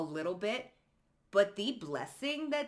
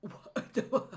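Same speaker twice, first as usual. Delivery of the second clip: shocked; 5 semitones higher than usual. Retold or imitated. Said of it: "This is also what people are like".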